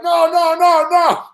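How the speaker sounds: high-pitched, frantic string of no's; mock-alarmed, high and urgent; alarmed protest. Acting out his alarm at fighter.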